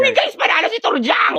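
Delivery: high, bright shout of victory; excited cheer. Acted. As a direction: cheer for your friend's win. Friend announces the win.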